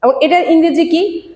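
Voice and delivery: ordinary talk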